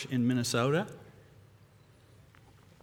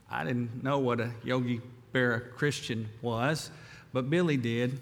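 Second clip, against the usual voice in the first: usual speaking voice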